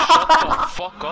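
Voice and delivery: yelling it through laughter; laughing shout. Real, not acted. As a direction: shout while cracking up. Laughing retort in team chat.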